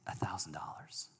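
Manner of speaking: whispered, ashamed admission; sheepish whisper. Retold or imitated. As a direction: barely breathe the amount out.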